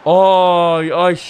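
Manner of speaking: loud high pained ay; dismayed cry. Real, not acted. Gamer wincing at a bad pass, dismayed. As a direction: wince at the play.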